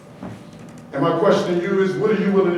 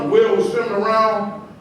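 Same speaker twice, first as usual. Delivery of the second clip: normal talking voice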